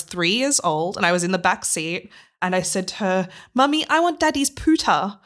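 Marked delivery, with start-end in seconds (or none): 3.5-5.2 put-on high, childlike little-girl voice; toddler voice. Three-year-old asking mum in the car, childlike. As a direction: ask like a three-year-old begging for the computer.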